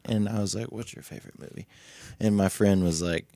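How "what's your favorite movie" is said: unvoiced whisper; a hushed whisper to friends. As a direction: ask it under your breath. Hushed talk among friends.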